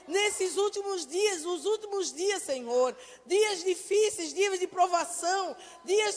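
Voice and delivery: fervent prayer. Acted. Pastor leading the congregation in loud fervent prayer. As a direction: pour out the prayer with fervour.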